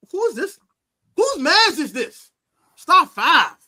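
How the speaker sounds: high, loud, slow mock panic; mock panicked fan. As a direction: play the rattled rival fan. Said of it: "I did an Eagle fan like".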